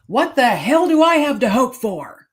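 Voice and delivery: angry yell. Retold or imitated. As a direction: yell it out, hopeless and furious. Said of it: "and then yelled at me".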